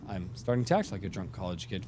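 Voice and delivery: normal talking voice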